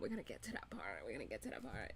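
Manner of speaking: low, very soft, quick mutter; hushed note to self; muttered aside. Real, not acted. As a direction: mumble it rapidly under your breath. Muttered repeat to herself, holding back.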